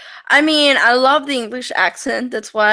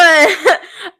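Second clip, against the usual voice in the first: laugh; 6 semitones higher, 5 dB louder than usual. Real, not acted. Laughing at the thought.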